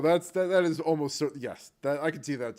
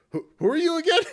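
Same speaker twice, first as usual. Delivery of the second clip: high, loud baffled question; 9 dB louder, faster than usual. Retold or imitated. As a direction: ask it totally baffled. Everybody puzzled at gnome leader.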